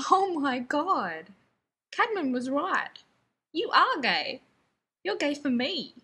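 loud, high, slow burst of discovery; excited revelation. Acted. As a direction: shout it like a sudden epiphany. Sudden realization shouted.

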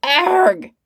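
high loud yelp of being shot; short sharp cry as the bullet hits; a hammed-up wounded yell; pained cry. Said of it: "There was an".

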